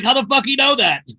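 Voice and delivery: high, loud mock outrage; acted outraged demand. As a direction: imitate the other side, loudly incredulous. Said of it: "the other side's like".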